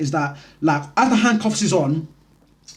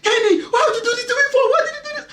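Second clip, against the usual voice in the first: very high, wailing mum with a laugh; mum's disbelief; faster than usual. Retold or imitated. Mum wailing at her son in disbelief, very high. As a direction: cry out like a shocked mother. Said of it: "my mum's all looking at me like".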